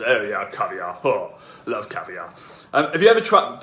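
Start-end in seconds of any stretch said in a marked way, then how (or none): 0.0-2.3 posh caviar voice. Pretend. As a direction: play a snob who adores caviar. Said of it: "don't know why I say it in that voice".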